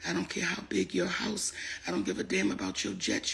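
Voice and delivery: ordinary talk, nothing marked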